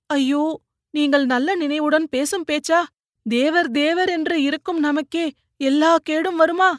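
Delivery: high, pleading tearful voice; lamenting. A narrator's reading. Wife protesting to her paralysed husband, high tearful lament. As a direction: grieve and protest at once.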